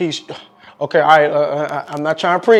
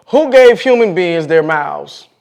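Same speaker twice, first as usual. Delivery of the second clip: slow, louder proclaimed scripture; scripture reading; 6 dB louder, slower than usual. Acted. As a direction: read it out with weight. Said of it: "let's read it from the scripture".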